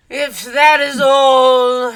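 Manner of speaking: loud, high, long-drawn butler; put-on butler voice. Butler asks leave to go, loud drawn-out voice. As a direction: stretch it out slowly and loudly. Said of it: "you see he goes".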